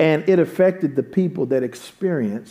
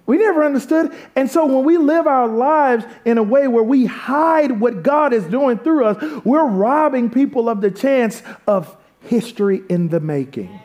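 higher-pitched, driving sermon delivery; impassioned preaching; 8 semitones higher than usual. Real, not acted. Pastor pressing his point on the congregation, impassioned preaching. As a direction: preach it with rising urgency.

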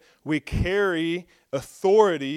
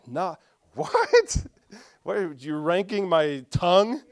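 incredulous reaction; 3 semitones higher, slower than usual. Retold or imitated. Preacher replaying his reaction to being ranked, incredulous. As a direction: replay being taken aback, voice jumping up.

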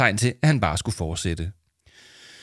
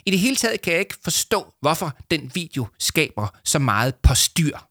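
higher-pitched pompous show-off; self-congratulating story character; self-satisfied boaster; 8 semitones higher than usual. Acted. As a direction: brag proudly, stretching the final cheer. Leo dismisses the fuss.

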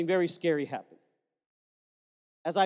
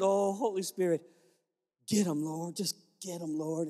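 much softer, low, murmured prayer under the breath; silent inner prayer; 3 semitones lower, 5 dB quieter than usual. Retold or imitated. Pastor praying silently for the man beside him, hushed and pleading. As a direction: pray inwardly, barely voiced, begging. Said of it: "began to pray in the Spirit in my mind".

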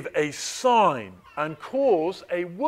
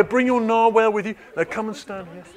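ordinary delivery

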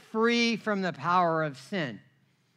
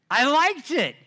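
emphatic confession; 7 semitones higher, 7 dB louder than usual. Real, not acted. Confessing loud to congregation.

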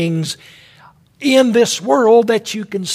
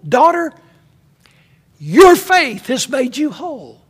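higher, louder preaching proclamation; proclaimed blessing; 4 semitones higher, 4 dB louder than usual. A narrator's reading. Preacher proclaims Jesus's words to the woman, loud and high. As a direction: ring it out to the congregation.

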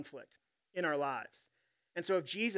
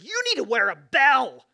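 loud, high-pitched yell of surprise; startled shout; 7 semitones higher, 12 dB louder than usual. Retold or imitated. Pastor reacting to a friend who crept up, startled shout. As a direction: blurt it out at full volume, startled. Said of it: "when you get surprised or scared".

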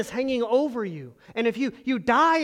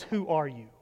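hushed wondering question; 7 semitones lower, 5 dB quieter than usual. Retold or imitated. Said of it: "what she's saying is".

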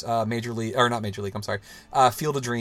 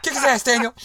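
cheering shout; 13 semitones higher, 8 dB louder than usual. Acted. Cheering on movie hero.

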